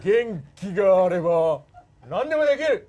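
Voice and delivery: loud, jutting-jaw wrestler shout; Antonio Inoki impression. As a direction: bark it like Inoki. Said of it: "モノマネで".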